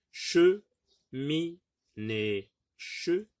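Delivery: "Che", "mi", "né" apart, "Che" high; syllable by syllable. Shown as a demonstration. Teacher showing three syllables, "Che, mi, né" separated. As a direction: give each of "Che, mi, né" its own beat. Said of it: "trois syllabes".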